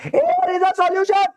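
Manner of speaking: high, slow and loud; dramatic reveal. Acted. Teacher declares air a solution, high and slow. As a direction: sing it out like a showman. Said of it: "teacher punches key line".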